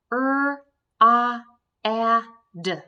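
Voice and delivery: loud, separate sounds ah and d; tapping out sounds. Shown as a demonstration. Teacher tapping a word for young readers, loud separate sounds. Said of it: "that doesn't make sense".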